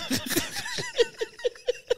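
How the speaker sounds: high, helpless laughter; real laugh, running on. Real, not acted. Laughing at his own alarm joke, high helpless laugh.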